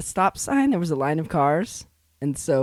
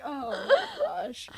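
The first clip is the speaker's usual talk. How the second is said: aghast; 3 dB quieter than usual. Retold or imitated. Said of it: "And I was like".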